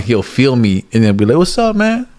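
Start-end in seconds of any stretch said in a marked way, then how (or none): 1.4-2.1 high, upbeat imitated "what's up"; friendly greeting. The guy greeting him back, high and friendly.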